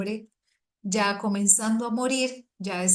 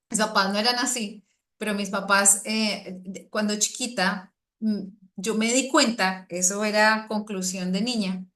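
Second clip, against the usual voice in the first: ordinary delivery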